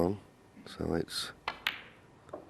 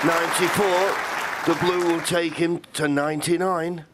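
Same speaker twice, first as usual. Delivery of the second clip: excited; 9 semitones higher, 5 dB louder than usual. Real, not acted. Commentator reacts to big break.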